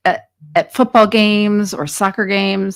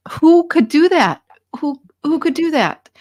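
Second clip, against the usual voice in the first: outraged; 8 semitones higher, 4 dB louder than usual. Real, not acted. Outraged at parents hurting children.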